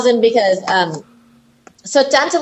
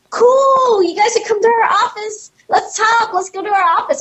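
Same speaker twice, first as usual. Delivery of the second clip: put-on drunk producers; 13 semitones higher than usual. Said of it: "And they're like".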